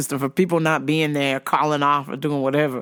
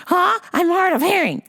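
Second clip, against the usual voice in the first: high put-on old lady, feigning deafness; mock old customer; 12 semitones higher than usual. Old customer dodging the cashier, mock deaf old lady.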